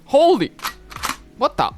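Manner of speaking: loud startled exclamations; shocked yelps, breaking off mid-phrase. Real, not acted. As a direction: jolt with shock, cutting off each outburst. Startled by sudden loud event.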